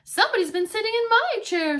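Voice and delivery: Mama Bear's outraged complaint. Acted. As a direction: say it indignantly as Mama. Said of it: "said Mama Bear".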